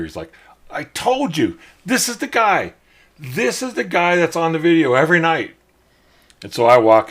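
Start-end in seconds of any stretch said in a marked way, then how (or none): 0.7-5.6 a high, loud call across the bar; imitating a yell. Fan yelling to the bartender at the comedy club, high and loud. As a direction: shout it to the bartender like you won a bet. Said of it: "he yells out into the, over to the bartender".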